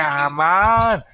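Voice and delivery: fed up with the interruption; exasperated. Real, not acted. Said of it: "three videos in a row".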